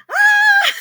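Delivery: piercing high shriek of delight; joyful scream. Retold or imitated. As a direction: shriek like seeing the ring for the first time. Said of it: "I just screamed".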